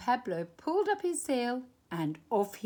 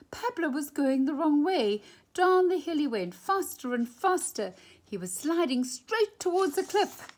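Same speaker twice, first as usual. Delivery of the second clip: louder, higher, pushing the tension as he slides; rising alarm; 7 semitones higher, 6 dB louder than usual. A narrator's reading. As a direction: tell it like a runaway sled racing to the edge. Pablo slides toward a cliff.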